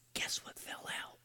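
hushed storyteller's whisper; suspenseful whisper. Retold or imitated. Grandpa asking the grandkids to guess, suspenseful whisper.

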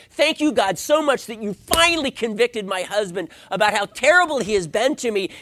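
high, loud, self-righteous thanks; hammed-up praying at your spouse, loudly; mock grateful prayer. Wife thanking God for convicting her husband, mock-pious.

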